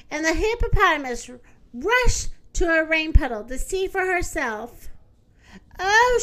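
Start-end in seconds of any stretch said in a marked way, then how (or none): 5.8-6.2 high, loud gasp of dismay; shocked cry. Acted. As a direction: recoil at her own reflection. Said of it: "she cried out upon seeing her reflection".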